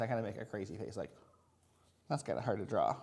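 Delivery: normal talking voice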